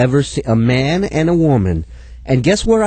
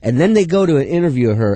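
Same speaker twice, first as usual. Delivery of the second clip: normal talking voice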